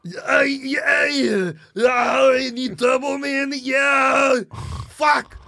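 high, straining action-hero groans and yells; Arnold Schwarzenegger. Acted. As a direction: come in hot, straining and crying out. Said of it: "Arnold, you kinda came in hot there".

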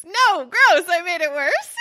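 loud, high, disgusted yelp; grossed-out squeal. Real, not acted. Reacting to her own gross joke to her co-host, squealing.

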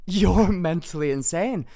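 slow, loud words broken by a laugh; laughing in disbelief. Retold or imitated. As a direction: laugh through the insult, amazed. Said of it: "I couldn't help but laugh".

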